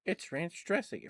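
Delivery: mock surprise. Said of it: "that killed me".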